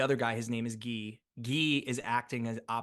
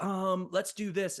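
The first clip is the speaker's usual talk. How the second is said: higher, slow hesitant director; imitating the director; 8 semitones higher, slower than usual. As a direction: mimic the director drawing the words out slowly. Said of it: "David's like".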